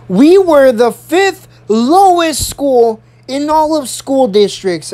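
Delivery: slow, high, emphatic announcement; principal's address. Retold or imitated. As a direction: imitate a principal driving the point home. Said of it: "She says".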